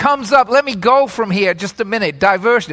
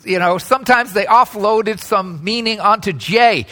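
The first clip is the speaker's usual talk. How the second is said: usual speaking voice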